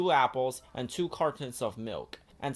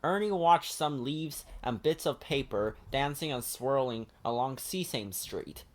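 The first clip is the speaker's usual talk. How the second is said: ordinary delivery, nothing marked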